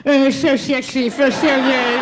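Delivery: slurred, mushy mock pillow talk; botched sexy talk. Her sexy talk comes out wrong in bed, slurred and botched. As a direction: slur every s into sh, garbling the seduction. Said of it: "but it came out like".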